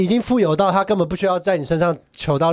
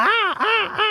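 crow caw. Retold or imitated. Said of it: "乌鸦是啊".

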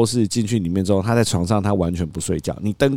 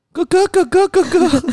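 put-on very high, louder excited toddler call; imitating toddler; 5 dB louder, slower than usual. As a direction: squeal like a delighted little boy. 模仿孩子叫狗狗. (高声模仿小孩兴奋叫)

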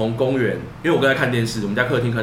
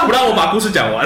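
words squeezed out between laughs; laughing, half complaining; after laughing hard; 7 semitones higher, 6 dB louder than usual. Real, not acted. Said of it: "我也笑到滴眼泪".

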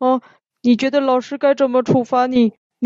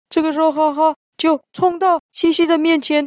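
ordinary delivery

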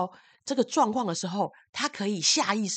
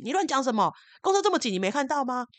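defensive retort; 6 semitones higher, 3 dB louder than usual. Retold or imitated. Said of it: "那男的马上转过来就说".

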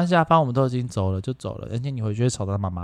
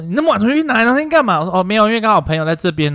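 quick, high, loud retelling; excited; 9 semitones higher, 6 dB louder, faster than usual. Retold or imitated. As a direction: rattle it off fast and higher. Mother's question, quick excuse.

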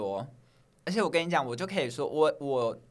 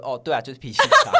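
laughing; 10 dB louder than usual. Retold or imitated. Mock accepting label, laughing.

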